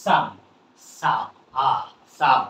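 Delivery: "সাম" broken into "সা" and "আ", then whole; short "ah" sound. Shown as a demonstration. Teacher breaks the word down for learners, "সাম" with "ah". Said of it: "আ sound হচ্ছে".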